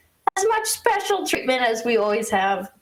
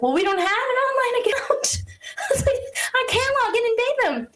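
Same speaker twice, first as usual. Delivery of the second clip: much higher, near-crying exasperation; exasperated retort; 13 semitones higher, faster than usual. Retold or imitated. Retelling vendor call, exasperated high squeak. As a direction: squeak it out in disbelief. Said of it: "And I was like".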